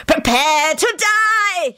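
high, very drawn-out villain threat; dramatic scream. A narrator's reading. Scully threatens Lupin's life, screaming dramatically. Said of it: "he screamed dramatically".

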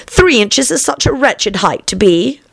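put-on high, slow girlish grumble. A narrator's reading. Alice complains to caterpillar.

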